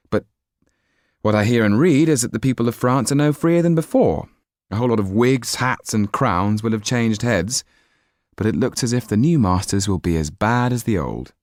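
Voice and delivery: put-on young Jan. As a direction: speak up with youthful idealism. Narrator voices young Jan.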